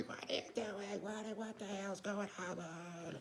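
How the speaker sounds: high-pitched, softened scream imitating his wife; mock wife's scream. Panicked wife to weeping husband, mock scream. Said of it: "My wife screaming at me".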